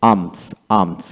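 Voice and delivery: আর্মস with the L silent, said as "আর্মস"; silent L demo. Shown as a demonstration. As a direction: say আর্মস softly without any L sound. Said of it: "এ এল এম এস". (এল ছাড়া আর্মস উচ্চারণ)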